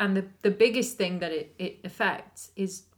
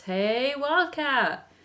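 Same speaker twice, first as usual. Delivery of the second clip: drawn-out, higher-pitched mock call; mock shout; 6 semitones higher, much slower than usual. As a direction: imitate calling out to a teammate, stretched out.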